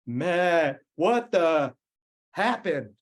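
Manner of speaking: angry coach confronting his rider after the crash; forceful. Retold or imitated. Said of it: "he was a little more forceful".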